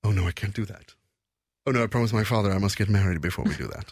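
demure mock prude declining in English; chaste. Shown as a demonstration. Chaste character turns down a date, demure refusal. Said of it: "Kysk, då är man ju mer".

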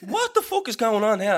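high, startled retold swear; high mock disbelief; shocked outburst. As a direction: sound floored by the news.

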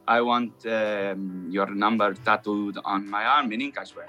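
Spanish accent. Shown as a demonstration. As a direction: feel out each word with a Spanish accent.

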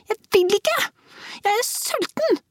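put-on very high defiant child's wail; little girl near tears, refusing; tantrum. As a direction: whine on the edge of a tantrum.

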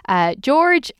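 usual speaking voice

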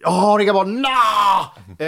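hammed-up furious roar, pitched high; Börje Ahlstedt. Comedian replaying his Börje Ahlstedt outburst, loud mock rage. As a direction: bellow it, ending in an enraged cry. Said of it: "Det var i Börje Ahlstedt".

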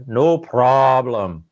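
put-on slow, heavy Russian accent on "No problem"; thick Russian accent. Pilot reassuring the stranded passenger in a slow thick Russian accent. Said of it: "in a very thick Russian accent".

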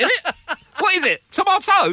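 high, loud disbelief; mock baffled. Dad pretending to doubt the wife's tomato, amazed. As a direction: act astonished at the word. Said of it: "I went".

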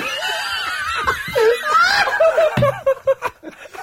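long, very high squealing laugh; helpless laughter. Real, not acted. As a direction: lose it completely laughing. Laughing at own retort.

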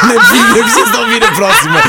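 laughing hard, high-pitched. Real, not acted. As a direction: burst out laughing while talking. Laughing at the absurd choice.

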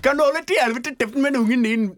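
put-on high, loud Indian-accented scolding; Indian-accented Norwegian. Attendant tells mother to silence her child, high Indian accent. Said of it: "Indisk norsk".

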